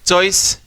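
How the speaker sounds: Choice said loud and high, set apart; presenting the word. Shown as a demonstration. Teacher introducing the example word choice. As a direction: announce choice clearly to the class. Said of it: "को देखो".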